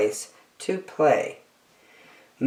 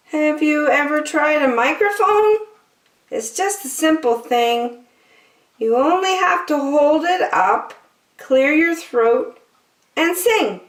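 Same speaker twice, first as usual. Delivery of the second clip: higher, lightly voiced sheep; a polite little sheep, pitched up; 13 semitones higher than usual. Sheep compliments Bear, in a high sheep voice.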